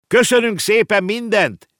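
put-on boy shouting thanks back as they leave; calling back. A narrator's reading. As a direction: shout your thanks back over the shoulder. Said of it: "kiáltott vissza a fiú".

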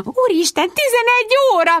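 shrieking. A narrator's reading. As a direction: squeal it like you just noticed the time. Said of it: "visította Voni".

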